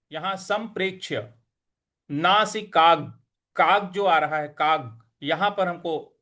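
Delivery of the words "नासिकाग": usual speaking voice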